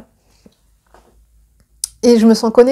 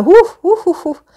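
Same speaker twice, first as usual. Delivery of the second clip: high, loud puffs of exhaustion, then fading; spent, puffing breaths; exhausted puffing; 7 semitones higher, 3 dB louder, slower than usual. Real, not acted. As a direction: blow out air, worn out after a long call. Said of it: "Je suis épuisée".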